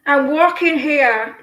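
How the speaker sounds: loud, slow New York "I'm walkin' here"; Yankee accent. Acted. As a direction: call it out in a drawn-out Yankee accent. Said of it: "Don't they say".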